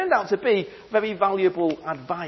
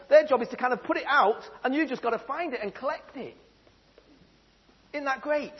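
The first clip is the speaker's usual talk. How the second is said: normal talking voice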